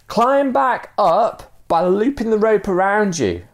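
high, slow shouted instruction; drawn-out high-pitched shout; calling instructions. Acted. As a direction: call it slowly down to someone below.

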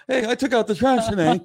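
high-pitched mock boy showing off; boastful boys. Boy bragging to parent about chores, high mock boast. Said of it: "Like the boys go".

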